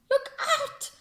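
high warning cry; cried warning. Acted. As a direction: warn everyone to hide. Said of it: "the others cried".

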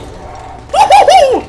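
falsetto woo-hoo; high gleeful whoop. Real, not acted.

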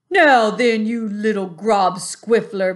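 loud, slow, menacing giant voice; booming giant. A narrator's reading. Bully giant mocking the BFG, loud and slow. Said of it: "boomed the flesh lump eater".

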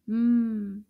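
loud hummed Mm; long held humming Mm; mm sound. Shown as a demonstration. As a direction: hum the Mm firmly. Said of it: "begins with the mm sound".

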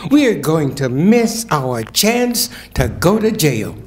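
expressive poetry reading; poem reading. Acted. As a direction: read the poem with rising fervour. Reads a poem aloud.

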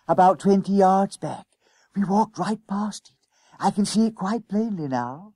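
put-on high, hushed boy's voice; schoolboy, excited discovery. A narrator's reading. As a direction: report the discovery in a high boyish half-whisper.

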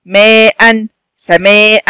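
"ماء" and "سماء" with long aa, then a separate "-an"; tanween after hamza. Shown as a demonstration. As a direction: break before the final "an" in "ماء" and "سماء". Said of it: "آخر حرف همزة وقبلها مد بالألف".

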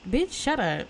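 ordinary talk, nothing marked

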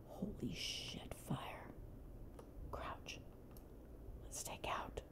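hushed, barely voiced sneaking; whispering. Real, not acted. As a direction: whisper so no one hears. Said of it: "quietly come up the ladder".

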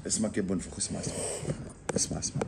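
hushed urging to listen. Real, not acted. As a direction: drop your voice so the sound can be heard. Hushing so the hiss is heard.

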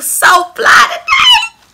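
shrieking way up high, loud and drawn out; excited squeal. Real, not acted. Vlogger thanking her live tribe, ecstatic high squeal. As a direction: let the joy break into a squeal.

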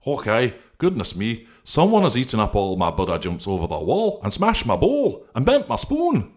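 high, loud, climbing to a squeak; a small voice rising to a squeal; Integrity finding his bowl smashed, rising to a squeak; Integrity's voice. Acted. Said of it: "said Integrity".

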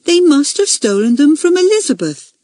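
put-on high, important little girl's announcement; self-important. A narrator's reading. Junior girl naming Elizabeth to the meeting, self-important. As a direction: make the big revelation, pitched high. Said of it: "she said importantly".

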